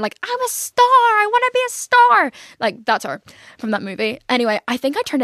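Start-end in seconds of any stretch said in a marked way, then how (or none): 0.2-2.3 high, loud imitation of an unhinged scream; Pearl. Quoting the TikTok clip from the movie, manic screech. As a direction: screech it like a girl going insane. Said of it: "have you ever heard the TikTok song".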